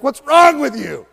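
loud, high, slow yell; pastor yelling. Retold or imitated. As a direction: bark the question at him like a pastor. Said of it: "'Cause I yell at you".

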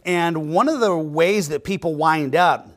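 normal talking voice

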